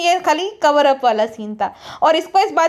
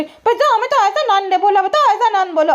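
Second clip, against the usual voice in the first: higher, whiny mock complaint; mimicking complaint; 6 semitones higher than usual. Vlogger mocking the woman complaining to Pizza, higher whine. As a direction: imitate her whining to a friend. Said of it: "pizza से complain करी".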